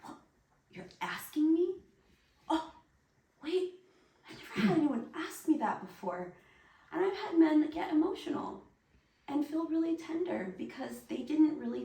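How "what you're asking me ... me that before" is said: higher, fast, astonished rush; stunned man. Retold or imitated. Teacher imitating a stunned man being asked, higher and fast. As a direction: imitate a man who can't believe he's been asked. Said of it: "who were so stunned".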